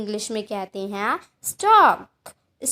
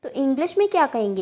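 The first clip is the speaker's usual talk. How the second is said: usual speaking voice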